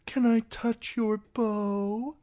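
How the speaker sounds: high, slow, coy imitation; mimicking a girl. A narrator's reading. Singer quoting a smitten maid, high mock-girlish voice. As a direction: imitate her, high and drawn out.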